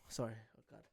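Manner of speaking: usual speaking voice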